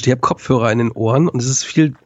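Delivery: ordinary talk, nothing marked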